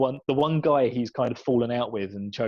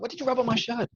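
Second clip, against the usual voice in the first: high-pitched mock freak-out; 11 semitones higher than usual. Imitating guy whose shirt got rubbed, freaked out. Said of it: "freaked out about it".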